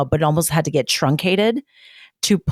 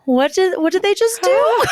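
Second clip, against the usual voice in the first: high baffled voice; confused viewer; 12 semitones higher than usual. Retold or imitated. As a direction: squeak it out, bewildered. Imagined confused viewer quote.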